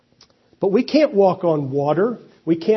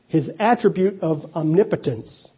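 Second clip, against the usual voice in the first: ordinary talk, nothing marked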